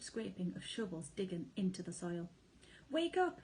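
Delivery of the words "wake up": high, loud urgent shout; alarmed father fox shouting wake up. A narrator's reading. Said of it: "he shouted".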